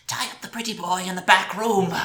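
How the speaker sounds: high thin nasal mimic. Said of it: "In a thin and nasally voice".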